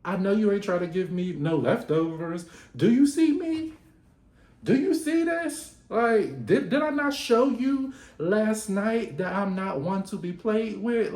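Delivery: high, loud indignant scolding; Celine scolding. Retold or imitated. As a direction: put him in his place. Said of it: "And then she said".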